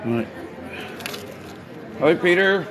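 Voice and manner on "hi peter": high called greeting; greeting across the street; calling out. Real, not acted. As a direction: sing out hi to a passing friend.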